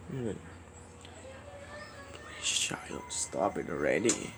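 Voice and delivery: soft whispered demand to stop; whispered plea. Real, not acted. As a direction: beg wearily under your breath.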